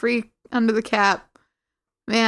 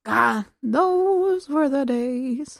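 long-drawn, higher nostalgic sigh of a line; slow, wistful reminiscing, pitched up; 5 semitones higher, much slower than usual. Real, not acted. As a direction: draw it out fondly, remembering better times. Wistful recall of childhood prizes.

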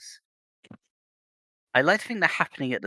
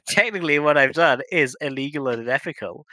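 higher, louder gleeful villain voice; evil voice; 6 semitones higher, 8 dB louder than usual. Pretend. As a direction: ham it up like a cartoon villain. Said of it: "that was my evil voice".

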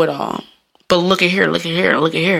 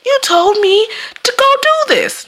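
high-pitched mock complaint; mock accusation. Friend blaming the advice-giver afterwards, high mock accusation. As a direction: imitate someone throwing the blame back, voice shooting up. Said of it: "come back on me and be like".

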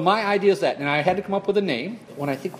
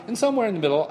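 ordinary delivery

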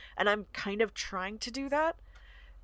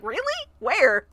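surprised question; 5 semitones higher, 8 dB louder, slower than usual. Retold or imitated. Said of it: "they're like".

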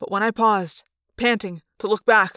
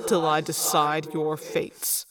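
low, slow, soft menacing voice; Visser Three gloating; 5 semitones lower, 4 dB quieter, slower than usual. A narrator's reading. Visser Three taunting the one still free, low slow menace. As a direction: gloat over your captives slowly.

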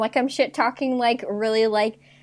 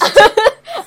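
loud burst of laughter; 13 semitones higher, 11 dB louder than usual. Real, not acted. Laughs at friend's joke.

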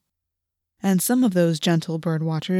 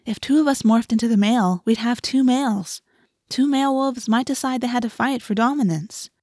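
put-on higher, insistent girl's voice; weary explaining; 5 semitones higher than usual. A narrator's reading. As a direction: explain it for the tenth time. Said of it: "Cassie explained for the tenth time".

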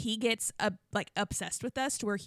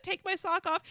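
ordinary talk, nothing marked

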